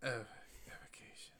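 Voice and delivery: breathy whisper of the word; hushed aside. Acted. Parent hushes the word evocation in a whisper.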